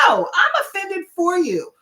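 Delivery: retold protest, high and emphatic; indignant retort. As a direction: object firmly on a friend's behalf. Retelling her indignant correction.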